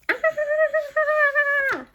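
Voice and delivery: a long very high "ah" of alarm; high alarmed cry. Acted. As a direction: let out a high startled wail. Elephant alarmed again.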